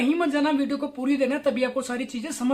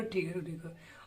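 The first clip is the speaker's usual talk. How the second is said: much softer, lower meek mumble; meek giving in; 8 semitones lower, 15 dB quieter than usual. Retold or imitated. Mocking a viewer who takes insults silently, meek soft mumble. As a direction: swallow the insult and mumble along. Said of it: "तुम उसको जवाब ही नहीं देना".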